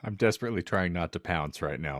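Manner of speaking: ordinary talk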